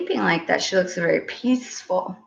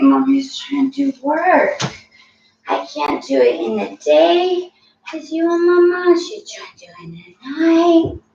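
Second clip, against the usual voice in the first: ordinary delivery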